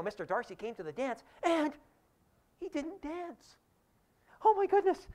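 clutch your pearls, rising to a shriek; gossiping society lady. Retold or imitated. Society ladies gossip about Darcy not dancing, high and scandalised.

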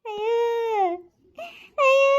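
anguished high moan; crying wail. Acted. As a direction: cry out in grief. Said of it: "ಅಯ್ಯೋ ಸುಮ್ಕಿರಿ ಹೇಳ್ಬೇಡಿ".

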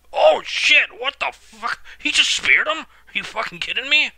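real shock at the spear; blurting out in total disbelief; stunned outburst. Real, not acted.

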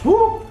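spicy whoop. Real, not acted. Reaction to the spice.

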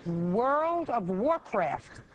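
"World of Warcraft" stretched to the limit; very slowly. Shown as a demonstration. Judge asking if anyone heard of it, very slowly. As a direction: savour each word of "World of Warcraft", drawn right out. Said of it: "Say it slowly".

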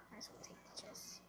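quick whisper to yourself; fast whispered repeat. Real, not acted.

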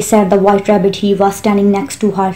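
ordinary delivery, nothing marked

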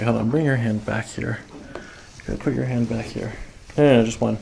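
usual speaking voice

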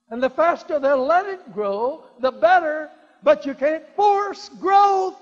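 building from loud to a high shout; preaching at full force. Acted. Preacher building to a shout before the congregation. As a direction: drive it home to the crowd.

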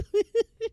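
high burst of laughter. Real, not acted. Cracking up while recapping the funny episode, squealing laugh. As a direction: let a high giggle burst out.

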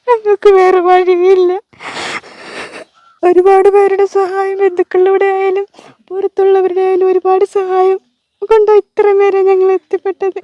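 crying, halting between phrases; real tearful appeal. Real, not acted. Mother appealing to everyone to save her child, crying. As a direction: plead for help through tears.

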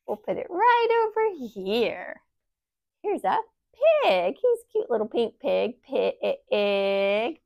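ordinary talk

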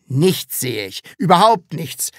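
loud, exasperated outburst; calling out. A narrator's reading. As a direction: exclaim it, rising hard on the repeat. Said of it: "rief Herr Taschenbier".

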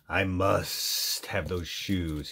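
low, slow, soft hissing want; snake's greedy thought. A narrator's reading. Snake to himself, eyeing the blue shoes, low slow longing. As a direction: murmur it slowly, coveting. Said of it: "he thought to himself".

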